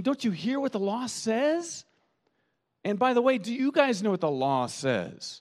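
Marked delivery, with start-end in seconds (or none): 0.0-1.8 higher, pointed question; incredulous. Retold or imitated. Paul pressing the Galatians, higher and pointed. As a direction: nudge them again. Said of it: "that's what it's saying".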